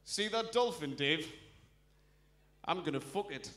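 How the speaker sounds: lightly played Geordie on the beach; Geordie bloke. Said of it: "Only a Geordie bloke could stand on the beach and go".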